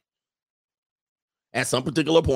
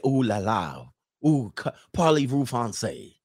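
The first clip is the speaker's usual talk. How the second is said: lightly imitated French suitor's accent; mock French accent. French dudes wining and dining your girls, mock French accent. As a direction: put on a playful French accent. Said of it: "they start talking that French bullshit".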